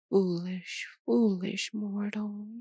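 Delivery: ordinary delivery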